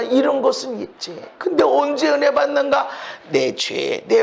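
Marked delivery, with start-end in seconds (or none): none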